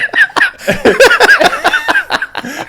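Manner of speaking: loud high wheezing laugh; real laughter breaking out; burst of laughter. Real, not acted. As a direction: lose it laughing. Laughing at the retold story.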